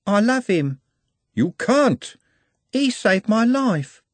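put-on high, girlish voice, lovestruck; high girlish voice, dreamy; dreamy young girl. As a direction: sound like a smitten young shopgirl. Juliet's voice.

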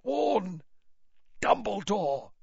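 high, strained, halting gasp; weak, broken plea; laboured effort. A narrator's reading. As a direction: force out the warning word by word. Stricken man struggling to speak.

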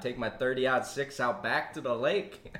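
hammed-up Minnesota accent, slowing at the end. Minnesotan off to the lake, mock Minnesota accent. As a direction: play a folksy Minnesota local. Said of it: "I love that accent".

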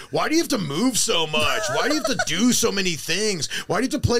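high, swooping mock indignation; mock complaining. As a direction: imitate a nagging online critic. Mimicking online haters' complaints.

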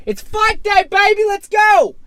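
high, loud burst of hype; hyped-up yell. Pretend. Fan rallying mates for fight day, yelling. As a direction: explode like a fan ready to party. Fan erupts after the build-up.

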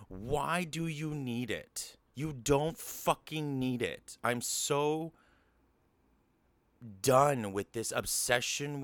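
rising, heated and exasperated; upset rant. Real, not acted. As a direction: vent the outrage, slowing on the swear words. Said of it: "I got really upset just then".

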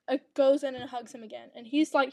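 normal talking voice

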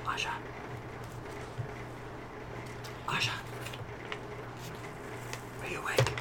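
hushed, voiceless whisper with long waits. Acted. Player checking on sleeping Asha, whispering. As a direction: whisper carefully to someone who may be asleep. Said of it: "I'm gonna whisper".

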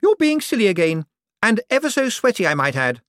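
Poochie's voice. Acted. To Cosmo, a greedy high-pitched rich woman.

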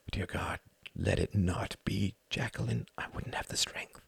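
whispered, breathless plea; barely voiced, praying under his breath; whispered prayer to himself in dread; hushed prayer. Panicked silent prayer.

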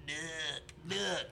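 drawn-out knock imitation in two separate bursts; imitated monster knock. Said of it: "He does the knock, he goes".

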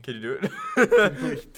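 slow laughing question. Real, not acted. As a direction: ask while laughing. Laughing request.

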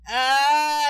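high, loud answering scream; imitated frightened child scream. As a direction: scream back in mock fright. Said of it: "we keep scaring each other".

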